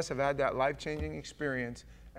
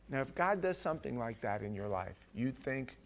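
usual speaking voice

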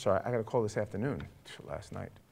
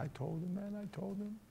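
high, soft, tearful retelling; reenacted grieving protest; imitated tearful voice; 9 semitones higher, 11 dB quieter than usual. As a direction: plead it quietly, pitched up as if crying. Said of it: "he's sitting on the bed crying".

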